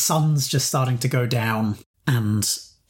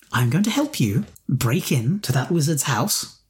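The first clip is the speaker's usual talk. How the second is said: ordinary talk, nothing marked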